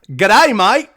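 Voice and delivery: loud, high, hammed-up Aussie greeting; over-the-top Australian accent. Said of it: "You gotta go over the top".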